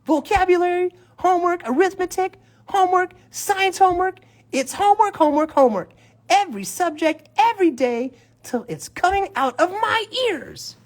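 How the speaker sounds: high, whining schoolboy complaint; whiny kid voice. Acted. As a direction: complain like a fed-up kid drowning in homework. Reading Brother's complaint aloud.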